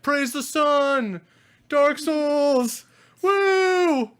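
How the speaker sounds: high, drawn-out fanboy hollering; crowd yell. Acted. Sixty convention fans see the Solaire shield, mock crowd yell. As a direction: whoop it up like a rabid crowd of fans. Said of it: "like, yell".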